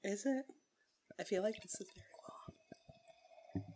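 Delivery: unvoiced hush under the sound; whispering. Real, not acted. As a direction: whisper so the mic keeps the fizz.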